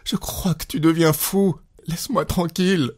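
high, tense protest; nervous. A narrator's reading. Wife to her raging husband, nervous protest. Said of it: "elle prononça nerveusement".

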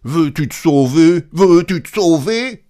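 high, loud repeated challenge; angry shout. A narrator's reading. Townsfolk driving Grand Claus out of town, shouting. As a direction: shout to chase someone off, rising.

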